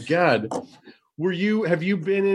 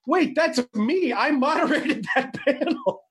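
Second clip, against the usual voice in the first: high-pitched, laughing disbelief; retold surprise; 9 semitones higher than usual. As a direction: act out a startled realization, half laughing. Retells recognizing own voice in video.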